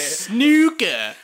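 Snooker said the American way, with a long oo; mock American Snooker; American accent.

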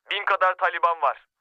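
ordinary delivery

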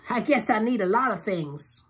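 high, coy tipsy purr; flirty Loretta. A narrator's reading. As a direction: suggestive tipsy tease.

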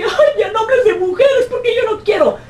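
high whiny falsetto parody; mock a whining objector; squeaky mocking voice; mocking complainer.

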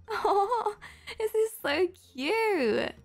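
high, drawn-out coo over something cute; melting with delight, slow and high; gushing. Real, not acted. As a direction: coo with delighted little laughs. Moved by a fan's message.

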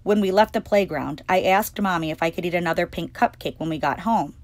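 ordinary delivery, nothing marked

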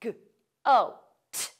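G, O, t sounded out one by one; stretched sounds. Shown as a demonstration. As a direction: say "G", "O", "t" as separate isolated sounds. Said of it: "Stretch it out".

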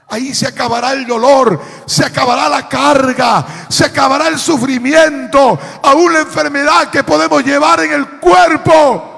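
fervent preaching, building phrase by phrase; predicación en crescendo. Real, not acted. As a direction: hammer each promise a little harder. (predicando cada vez con más fuerza)

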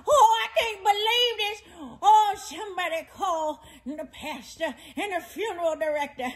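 hammed-up crying to the Lord; theatrical mock sobbing; dramatic wailing. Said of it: "Quit with the dramatics".

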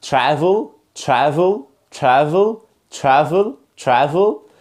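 "Travel" starting like "chravel"; "Travel" with a "chr" onset; correct American TR. Shown as a demonstration. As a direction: say "Travel" with t and r blended through a sh sound. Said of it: "يلا مع النطق الصح".

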